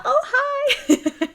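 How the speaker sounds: very high, laughing hi; a squeaky laughing hello; mock greeting. Replying to Nora's picture with a high laughing hi. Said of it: "And I'm like".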